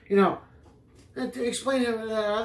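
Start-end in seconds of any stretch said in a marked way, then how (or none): none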